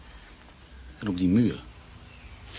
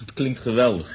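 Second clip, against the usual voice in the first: tipsy enthusiasm; 7 semitones higher, 10 dB louder than usual. Acted. Tipsy man enthusing to friend.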